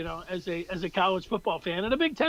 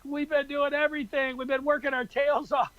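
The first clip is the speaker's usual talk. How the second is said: mock pleading, pitched up; higher-pitched, insistent quoted plea; emphatic plea; 5 semitones higher than usual. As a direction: imitate coaches pleading their case. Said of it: "emphatic pleas".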